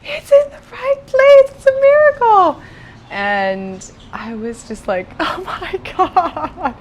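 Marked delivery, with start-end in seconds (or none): 0.0-2.6 high, loud mock accented gushing; shrieking it's a miracle; excited tech. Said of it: "she's like". 5.1-6.7 slow, high laughing oh my god; stunned laughing gasp; laughing disbelief. Retold or imitated. Stunned at miracle news.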